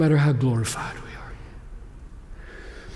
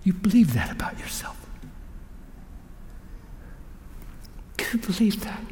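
whisper; 5 semitones higher, 9 dB quieter than usual. Real, not acted. Hushed intimate aside.

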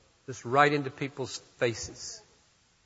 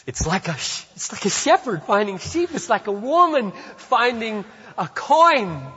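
higher and louder, rising joy; joyful answering back; 11 semitones higher, 6 dB louder than usual. Acted. Jesus answering the Pharisees about the party in heaven, joyful and rising. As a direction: answer back with growing delight. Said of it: "And he answers back".

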